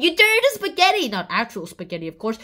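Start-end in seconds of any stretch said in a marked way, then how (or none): 0.0-1.1 higher, louder and fast; excited blurt. Real, not acted. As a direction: toss it out with glee. Gleeful explanation.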